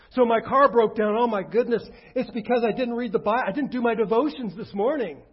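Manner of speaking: a hammed-up anxious churchgoer; mock worried believer. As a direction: parody a believer blaming bad luck on missed devotions. Mocking a superstitious believer.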